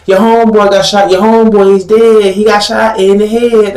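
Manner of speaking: high mock campus taunt; imitating a high-voiced heckler. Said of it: "big Judahite women at college campus saying".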